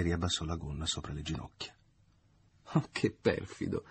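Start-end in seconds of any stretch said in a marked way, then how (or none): 2.7-3.8 falsetto lento e civettuolo. A narrator's reading. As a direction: fai la finta offesa in falsetto. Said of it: "ella ripeté in falsetto". (falsetto lento da finta offesa)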